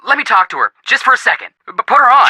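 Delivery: high, urgent begging; excited pleading. Acted. Joe begs to talk to the famous model on the phone, excited pleading. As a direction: plead frantically, climbing in pitch.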